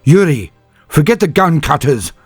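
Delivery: high, loud insistence; urgent shout. A narrator's reading. Senyavina overrides Vitko's plan, an urgent shout. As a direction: cut him off hard. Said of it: "Senyavina said".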